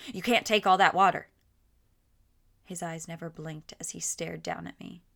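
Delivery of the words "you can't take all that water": shouting. A narrator's reading. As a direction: call out at her loudly. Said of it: "he shouted".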